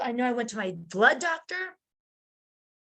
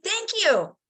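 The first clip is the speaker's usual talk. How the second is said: bright thank you; 9 semitones higher than usual. Retold or imitated.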